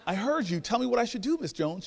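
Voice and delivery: high, exasperated plea; impatient. Retold or imitated. Man pressing elder neighbor for her advice, impatient. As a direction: push her for the answer, voice rising.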